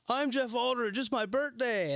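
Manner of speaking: mock stage intro, pitched high; cheery fake intro.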